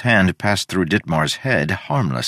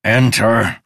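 grunted; 5 semitones higher, 5 dB louder than usual. Acted. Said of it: "Ditmar grunted".

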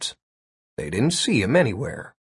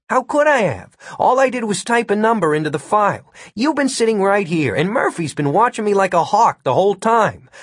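higher-pitched, insistent self-defence; indignant protest; 7 semitones higher than usual. A narrator's reading. Accused hacker defends himself to agents, indignant protest. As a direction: protest your innocence in a raised pitch.

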